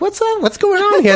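very high, comic confusion; retold bafflement. New Yorker retelling confusion on tour, comic bafflement. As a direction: act out being baffled, pitch shooting up. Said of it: "I'm like".

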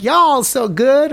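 high, slow Southern drawl, mimicked; imitated Southern accent. New Yorker imitating locals met on tour, Southern accent. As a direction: mimic a drawn-out Southern greeting. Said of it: "everybody like".

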